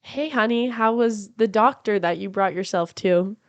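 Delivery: ordinary delivery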